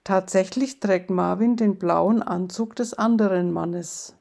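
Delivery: ordinary delivery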